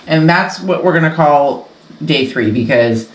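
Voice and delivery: usual speaking voice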